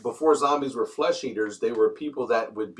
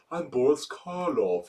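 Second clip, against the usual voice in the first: slow, soft lisping Karloff voice; lightly voiced slow lisping horror star; Boris Karloff impression; 4 dB quieter, slower than usual. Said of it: "he had a lisp".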